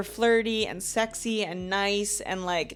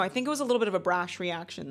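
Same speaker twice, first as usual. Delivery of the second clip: ordinary delivery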